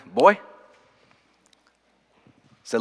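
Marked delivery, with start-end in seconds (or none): 0.1-0.3 short mock snap, pitch leaping upward; imitated snap. Pastor imitating how his mentor snapped at him, sharp rising snap. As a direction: snap it like a mentor pulling up a pupil. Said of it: "And he snapped at me".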